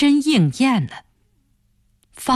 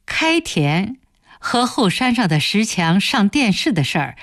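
ordinary delivery, nothing marked

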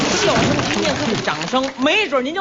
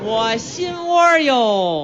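drawn out; 3 semitones lower, much slower than usual. Acted. Drawn-out setup line.

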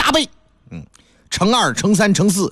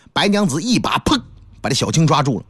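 usual speaking voice

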